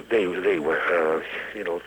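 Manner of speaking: ordinary delivery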